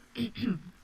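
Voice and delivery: theatrical throat clear before singing. Acted. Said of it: "coughed twice".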